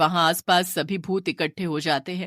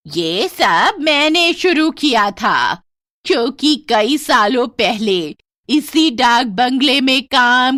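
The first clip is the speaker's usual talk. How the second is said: louder, higher, slow bitter ghost voice; vengeful ghost; 6 semitones higher, 8 dB louder, slower than usual. Acted. Ghost flower girl telling how a guest murdered her, vengeful. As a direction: pour out an old grudge.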